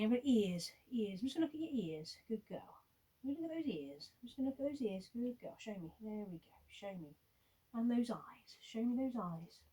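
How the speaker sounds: sweet-talking a puppy during a check-up; gentle puppy talk. Shown as a demonstration. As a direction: soothe her softly while checking her ears. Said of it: "get used to very gently saying".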